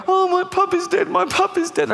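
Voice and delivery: put-on high whiny kid voice; mock child wail. Children mourning their dead puppy, high mock wail. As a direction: whine like a heartbroken little kid.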